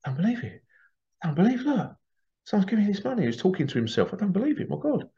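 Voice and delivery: high, amazed talking to himself; muttering disbelief. Retold or imitated. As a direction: mimic a man stunned by kindness. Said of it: "He goes".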